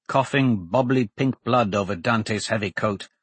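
ordinary delivery, nothing marked

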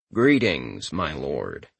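flat, low deadpan servant. Acted. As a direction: deliver it utterly deadpan. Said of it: "he said in his deadpan voice".

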